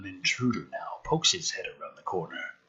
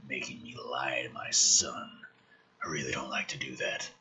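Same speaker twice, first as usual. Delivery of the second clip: low, soft whisper of a beaten hero; a low, quiet, defiant threat; hoarse wounded whisper; 10 semitones lower, 8 dB quieter than usual. Acted. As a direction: rasp it out through blood. Beaten McKenna's whispered lines.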